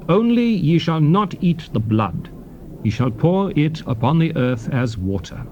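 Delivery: ordinary talk, nothing marked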